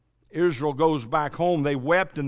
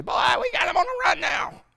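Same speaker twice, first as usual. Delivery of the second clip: gloating soldier; 13 semitones higher than usual. Acted. Gloating enemy soldiers, acted.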